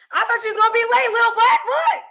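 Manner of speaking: high, fast, excited teasing; playful teasing. Real, not acted. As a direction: tease a guest in a high-pitched rush. Teasing guest who arrived on time.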